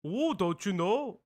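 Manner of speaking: drawn-out stab at a Midwestern accent; tentative, slow Midwestern accent impression; attempted Midwestern accent. Shown as a demonstration. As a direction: stretch the vowels in a mock Midwestern accent. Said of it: "that's not Midwestern".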